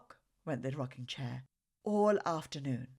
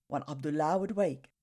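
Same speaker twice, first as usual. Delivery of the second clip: plain reading voice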